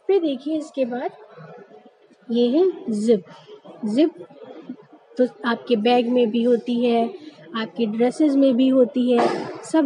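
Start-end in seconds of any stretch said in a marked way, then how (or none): 2.9-4.1 जिप as "zip", buzzing z; z sound in जिप. Shown as a demonstration. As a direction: start जिप on a z. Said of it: "इसे हम जिप नहीं पढ़ेंगे".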